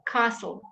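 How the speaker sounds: castle as kahsel, t left out; silent t castle. Shown as a demonstration. Teacher repeating castle for students. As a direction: leave out the t in castle. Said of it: "What letter did I leave out".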